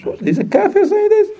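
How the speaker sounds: high squeaky disbelieving voice with a laugh; incredulous mock onlooker. Preacher mocking a shocked onlooker, high and laughing.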